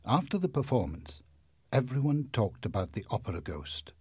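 ordinary delivery, nothing marked

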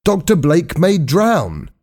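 high, slow, anxious warning; alarmed warning. A narrator's reading. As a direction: warn them gravely but up high. Hal warns of drowning.

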